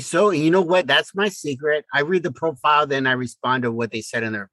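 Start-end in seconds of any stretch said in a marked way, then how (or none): none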